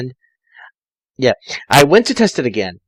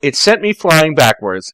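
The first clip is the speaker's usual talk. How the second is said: ordinary delivery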